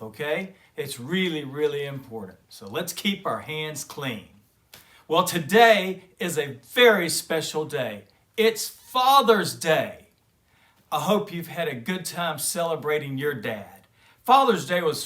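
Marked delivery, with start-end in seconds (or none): 5.1-10.1 excited announcement. Acted. Papa Bear announces Father's Day to the cubs, excitedly. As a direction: make the big day sound special.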